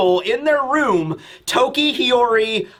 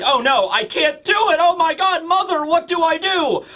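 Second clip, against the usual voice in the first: high-pitched, hammed-up panic; mock panicked crying; 7 semitones higher than usual. Panicking Momonosuke calling for his mother, mock tearful wail. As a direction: wail helplessly, pitch climbing. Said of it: "he begins to cry".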